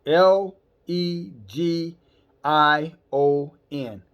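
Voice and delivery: each letter L E G I O N said apart, very slow; spelled out slowly. Shown as a demonstration. Preacher spelling the demon's name for the congregation, slow.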